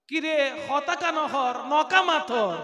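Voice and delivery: slow, emphatic Chittagonian phrase; Chittagonian dialect. Shown as a demonstration. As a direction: speak it in Chittagong dialect, slowly. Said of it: "চট্টগ্রামের আঞ্চলিক ভাষা". (চট্টগ্রামের আঞ্চলিক টানে ধীরে বলা)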